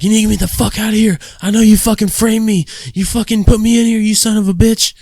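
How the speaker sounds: high, loud imitation of a raving inmate; angry yelling. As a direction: rant wildly at the guard. Crazed inmate accuses the guard.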